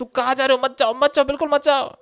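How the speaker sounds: high-pitched imitation of begging someone to stay; mock pleading. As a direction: playfully beg him not to go. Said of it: "मैं उनको पकड़ नहीं सकता तो पकड़ता". (जाने वाले पास्टर को रोकते हुए ऊँची आवाज़ में मज़ाकिया विनती)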